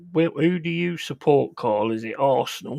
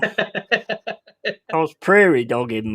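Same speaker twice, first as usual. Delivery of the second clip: slow, stretched and higher, savouring the phrase; drawn-out joke; 4 semitones higher, 5 dB louder, much slower than usual. Real, not acted. Co-host stretches out his toilet joke for the host. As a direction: drag out the words with relish. Joking about needing the toilet.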